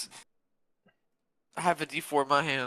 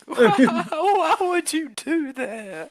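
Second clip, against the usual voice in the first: wailing plea; 13 semitones higher, 6 dB louder, slower than usual. Acted. Rudy bawling.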